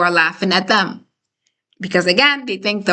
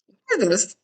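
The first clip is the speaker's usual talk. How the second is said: mock confrontation at lowered volume; crazy aggressive; 5 dB quieter than usual. Narcissist coming at you over a laugh, mock aggressive. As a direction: imitate an aggressive challenge, softer, with a pitch jump. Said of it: "just coming at you crazy aggressive".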